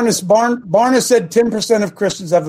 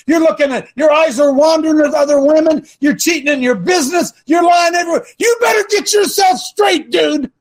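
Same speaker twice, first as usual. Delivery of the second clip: mock hellfire preacher; 6 semitones higher than usual. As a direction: thunder it like a fire and brimstone pastor. Preacher thundering at church folk.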